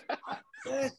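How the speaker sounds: high mock grumble; fed-up Ozzy. Ozzy giving up on the waiter, high mock grumble. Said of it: "I was like".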